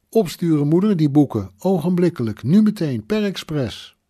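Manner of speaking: high, loud, emphatic commands; urgent ordering. A narrator's reading. Son telling mother to post the books, urgent ordering. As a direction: drive each instruction home urgently.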